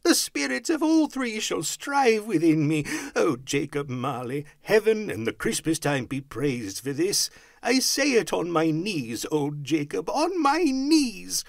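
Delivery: high, fervent outpouring of thanks; fluttered, glowing Scrooge. A narrator's reading. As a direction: exclaim it with overwhelmed gratitude. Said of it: "so fluttered and so glowing".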